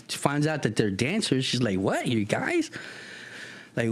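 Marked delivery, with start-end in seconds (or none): 1.8-2.7 high-pitched acted astonishment; surprised. Kathy finding out the guys are dancers, surprised high voice. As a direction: react in disbelief with pitch leaping up.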